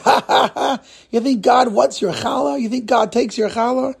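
high, incredulous jeering with a stagey laugh; laughing mockery. Cleaner ridiculing Joseph's offering to God, mocking laughter. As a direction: ridicule him through acted laughter. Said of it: "the assistant starts laughing".

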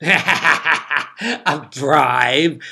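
loud, cackling disbelief; loud, derisive outburst; scornful cackle. A narrator's reading. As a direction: cackle it out as the drunken Duke. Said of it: "the Duke cackled".

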